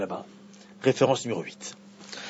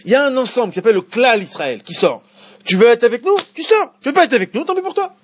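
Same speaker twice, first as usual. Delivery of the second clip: much higher and louder, driving every phrase home; full-force preaching; 5 semitones higher, 6 dB louder than usual. Acted. Rabbi preaching Sinai to his class, high and loud. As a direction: preach it to the whole room with fire. Preaches the old collective view.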